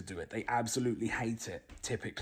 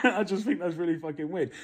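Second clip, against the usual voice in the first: louder and higher, laughing through the words; 8 semitones higher, 6 dB louder than usual. Real, not acted. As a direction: laugh while you call it weird. Laughing while saying it.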